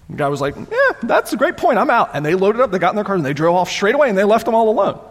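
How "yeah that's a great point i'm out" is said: much higher-pitched retold quote; high-voiced comic climb-down; mock hasty retreat. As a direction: play the captor backing out, pitched way up. Captor backing off in retold story.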